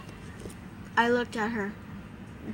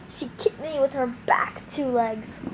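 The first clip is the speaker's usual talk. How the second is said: ordinary delivery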